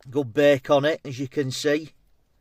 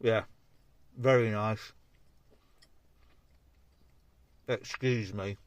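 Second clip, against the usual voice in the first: talking with mouth full; words round a bite of pie. Real, not acted. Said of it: "I shouldn't talk with my mouth full".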